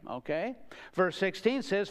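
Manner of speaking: ordinary talk, nothing marked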